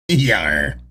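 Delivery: very low pirate growl. Pretend. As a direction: grunt it like an old sea dog. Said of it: "read the joke like a pirate".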